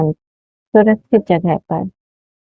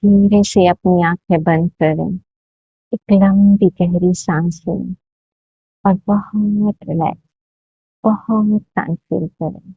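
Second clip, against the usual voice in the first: guided meditation; 2 semitones higher than usual. Acted. Leads a guided hypnotic meditation.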